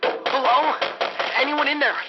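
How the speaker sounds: high, loud desperate shouts; frantic cries for help; calling for help. Acted. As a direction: yell through the locked door. Pounding on locked hospital door.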